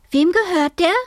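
put-on high, loud child's question; demanding girl. A narrator's reading. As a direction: demand it as a girl bursting into the room. Girl bursts in, demanding answer.

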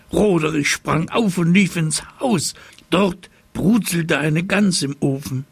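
high-pitched, urgent storytelling; agitated storyteller; alarmed narration. A narrator's reading.

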